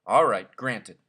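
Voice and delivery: a loud, magnanimous grant; generous. A narrator's reading. Headmaster granting ten days, loudly generous. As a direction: grant it grandly, like a favour. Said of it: "the headmaster said generously".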